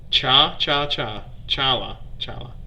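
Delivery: "chala" built from "cha", with an open AH; practising pronunciation. Real, not acted. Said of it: "my attempts to enunciate all these words".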